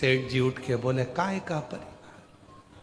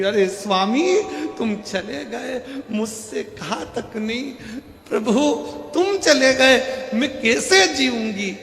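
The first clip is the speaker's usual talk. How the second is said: high, slow, mock-wailing lament; wailing widow; 9 semitones higher, 4 dB louder than usual. Wife mock-wailing over her husband's body. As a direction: ham up a wife's loud weeping over her husband. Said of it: "पत्नी धाड़ धाड़ के रोने लगी".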